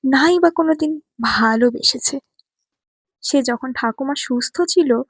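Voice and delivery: plain reading voice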